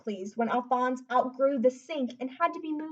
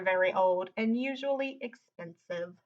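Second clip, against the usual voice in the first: ordinary delivery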